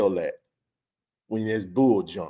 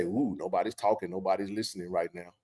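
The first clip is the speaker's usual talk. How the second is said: mock hush; 4 semitones lower, 9 dB quieter than usual. Mocking what complacent people say.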